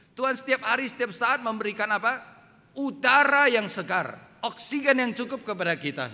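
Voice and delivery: high, loud pulpit proclamation; ringing sermon delivery, key words punched; forceful preaching. Real, not acted. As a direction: proclaim it boldly to the whole congregation.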